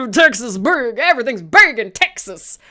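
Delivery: loud, badly faked Texas twang; hammed-up Texas accent, loud and clumsy. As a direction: boast like a mock Texan. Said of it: "I can't do a Texas accent".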